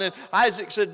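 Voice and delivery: ordinary talk, nothing marked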